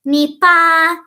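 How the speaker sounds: put-on high, loud, cutesy little-girl chirp; cutesy catchphrase. Fan quoting a little girl's catchphrase, in a high cutesy voice. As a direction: imitate a happy anime girl, pitched well up. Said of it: "that little catchphrase that's like".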